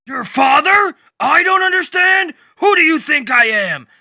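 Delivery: high, loud outraged shout; furious retort. Acted. Batman yelling back at Swamp Thing, outraged. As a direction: throw his words back at him.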